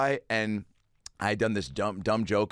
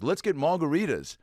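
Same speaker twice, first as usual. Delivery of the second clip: higher, bright Liverpool-tinged impression; 7 semitones higher than usual. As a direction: pitch it up like a chirpy Liverpudlian. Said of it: "he goes".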